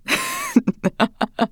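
shrill triumphant witch cackle; high evil cackle. A narrator's reading. Queen laughing over dead girl.

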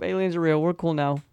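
ordinary delivery

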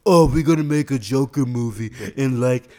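loud, mocking dumb voice; hammed-up imitation of a dumb person. Shown as a demonstration. Demonstrating for co-host how dumb people talk, mocking put-on voice. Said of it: "Sorry if you do sound like this".

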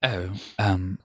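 a lightly voiced prim British gent, mild; hint of a stiff upper-lip Englishman; mock posh Brit. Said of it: "I found it all very British".

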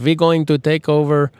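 a hint of a mock German villain; lightly voiced German world-conqueror; bad German accent. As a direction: try a German accent and fumble it. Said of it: "I don't have a really good German accent".

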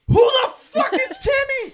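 slow, very high incredulous yell; outraged shout. Retold or imitated. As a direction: shout it out, drawing out every word. Acted outburst on hearing of Timmy.

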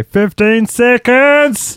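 high, slow, drawn-out trainer call; gym instructor impression. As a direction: stretch it like a shouting trainer. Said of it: "the guy who used to say".

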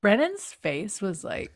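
ordinary talk

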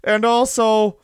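greedy cartoon wrestler; high goofy voice piling on Cokes; mock Outlaws voice. Voicing Outlaws at catering.

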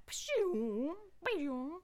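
vocal lightsaber-like whoosh; imitated power-on whoosh. Miming switching on the TV, imitated electronic whoosh. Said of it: "That's me turning on the T V".

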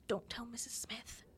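put-on hushed, breathless kid whisper; secret whisper. Kid whispering to a classmate, keep it secret from the teacher. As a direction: whisper it so the teacher won't hear. Kids hiding hand-holding from teacher.

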